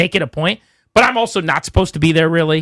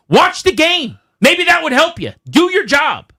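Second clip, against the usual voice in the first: retold shout; 11 semitones higher, slower than usual. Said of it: "I'm like".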